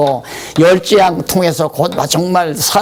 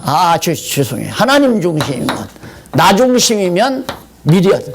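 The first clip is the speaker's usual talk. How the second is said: normal talking voice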